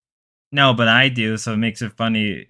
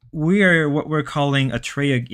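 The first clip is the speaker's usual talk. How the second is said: normal talking voice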